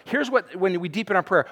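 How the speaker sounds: normal talking voice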